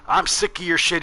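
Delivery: high-pitched mock old-man complaint; imitated freak-out. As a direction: imitate a fed-up neighbor ranting. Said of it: "cussing and yelling and freaking out".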